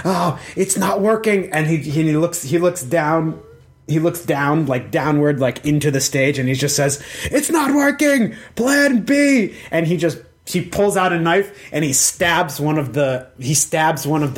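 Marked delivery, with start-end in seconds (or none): 0.0-1.5 higher voice, restrained dismay; a touch of worry, not raised in volume; wide-eyed alarm. Acted. Crinkle Lightfoot looking at the adventurer, mild higher-pitched alarm. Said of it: "eyes are wide. He's such, like". 7.3-9.5 very high, urgent cry; hammed-up alarm in a high strained pitch; panicked exclamation. Crinkle Lightfoot looking down into the stage, high panicked call. Plotter panics, switches to backup.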